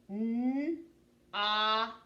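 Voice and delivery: held "N", gap, then long "ah"; sounded out. Shown as a demonstration. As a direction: say "N" and "ah" as separate sounds. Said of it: "Tell me the sounds we hear in not".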